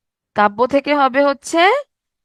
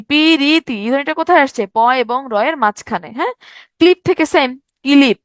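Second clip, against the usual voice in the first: normal talking voice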